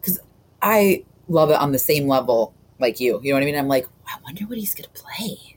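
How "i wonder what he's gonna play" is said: higher, hushed whisper; whispered inner thought. Retold or imitated. Co-host voicing her wondering about the clip, whispered.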